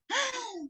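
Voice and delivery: high, soft intake of breath; shocked gasp. A narrator's reading. Narrator reacting to the swear word, high soft gasp. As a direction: gasp as if scandalized. Shock after child's rude remark.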